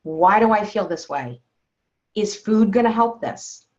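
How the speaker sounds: ordinary talk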